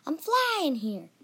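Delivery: high, drawn-out little leprechaun protest; small, peculiar voice. Acted. Tiny winged leprechaun to careless human, small peculiar voice. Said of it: "peculiar voice".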